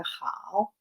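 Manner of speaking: drawn-out JAO with a long vowel; third tone. Shown as a demonstration. Teacher showing how the form is pronounced, long third-tone "jao". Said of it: "cómo se pronuncia esta forma".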